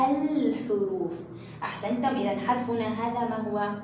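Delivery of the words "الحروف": "الحروف" slowly, with the ل held; pronounced lam. Shown as a demonstration. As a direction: stretch the L of الحروف before the ح. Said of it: "ستكون لام شمسية أم لام قمرية؟".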